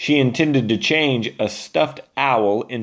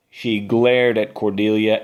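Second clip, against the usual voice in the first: usual speaking voice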